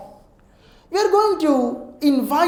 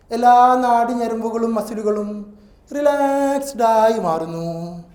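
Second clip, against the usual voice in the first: calm them limb by limb; guided relaxation; 4 semitones lower, 4 dB quieter, faster than usual. Acted. Guided relaxation over music. (ശാന്തമായ ധ്യാന സ്വരം)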